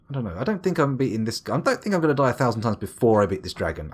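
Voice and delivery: ordinary talk, nothing marked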